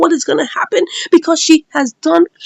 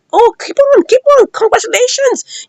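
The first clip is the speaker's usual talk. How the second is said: high-pitched sarcastic cheering; imitated scornful praise, pitched high; mocking congratulations; 9 semitones higher than usual. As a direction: jeer with mock applause in the voice. Retold quarrel, mocking the woman.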